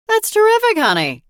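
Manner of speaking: high, loud fake cheer; mock enthusiasm. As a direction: gush without meaning it. Mother answers without looking.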